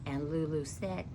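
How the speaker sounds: ordinary delivery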